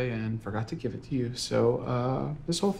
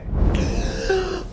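loud startled gasp; sharp gasp; 5 dB louder than usual. Acted. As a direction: gasp in shock. Shocked at the noise.

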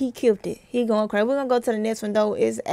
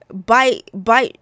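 ordinary talk, nothing marked

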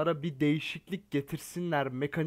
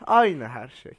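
exasperated; 4 semitones lower, 8 dB louder, slower than usual. Real, not acted. Ranting that nothing changed.

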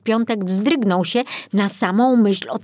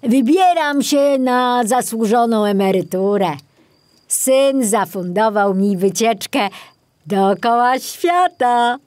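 higher, louder voice with a wink in it; retiring forester boasting about his trip round the world; cheerful old forester; 5 semitones higher, 5 dB louder than usual. Acted. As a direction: tell him the good news with a wink. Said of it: "mrugając wesoło".

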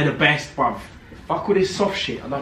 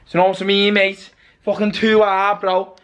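higher-pitched Liverpool lilt; jokey Scouse or Irish-tinged accent; slipping into Liverpool accent; 5 semitones higher than usual. Pretend. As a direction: break into Scouse mid-chat. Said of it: "Why do you always break into, like, a Liverpool accent".

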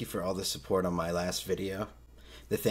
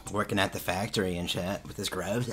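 ordinary talk, nothing marked

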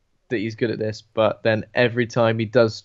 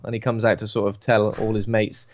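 ordinary talk

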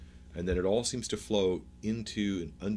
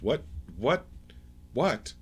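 higher, mildly alarmed repeated questions; startled questioning; 6 semitones higher, slower than usual. Acted. Azkazix stepping back with sword drawn, startled. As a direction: ask in lightly acted bewilderment. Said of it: "a very startled Azkazix".

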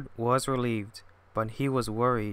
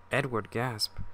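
usual speaking voice

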